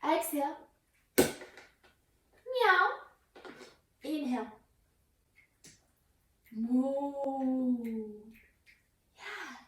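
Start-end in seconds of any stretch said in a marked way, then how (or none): 1.2-2.9 high playful mew; cat meow. Pretend. Teacher exhaling in cat pose, high playful meowing. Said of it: "Exhale into cat". 6.6-8.1 long, level, drawn-out moo; cow moo. Pretend. Teacher inhaling into cow pose, long gentle mooing. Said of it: "Cow goes moo".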